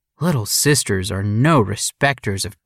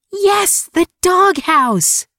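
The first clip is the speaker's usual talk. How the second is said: put-on high, loud, slowed excited girl; little girl voice; 4 dB louder, slower than usual. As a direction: cheer it like a delighted little sister. Little sister cheering.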